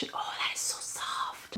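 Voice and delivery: hushed whisper of delight; awed whisper. Real, not acted. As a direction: whisper as if stroking something soft. Touching doll hair.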